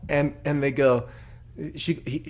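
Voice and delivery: ordinary delivery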